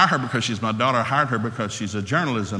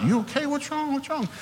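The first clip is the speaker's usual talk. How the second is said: high, anxious questions, softer; worried; 5 dB quieter than usual. Retold or imitated. Husband to crying wife after birth, high worried questions. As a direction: fret over her suddenly.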